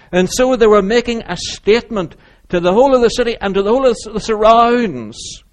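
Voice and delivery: swelling pulpit oratory, key words stretched out; full-force preaching. Acted. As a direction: preach it high and loud to a whole crowd.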